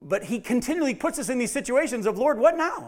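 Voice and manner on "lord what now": high-pitched cry of "what", falling on "now"; weary believer pleading with the Lord; exasperated plea. Acted. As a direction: throw your hands up at God. Believer crying out to God.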